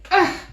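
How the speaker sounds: loud high effortful grunt; strained pushing ugh; straining grunt. A narrator's reading. Peacock strains.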